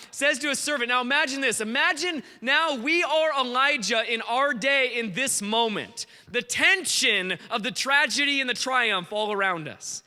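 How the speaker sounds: normal talking voice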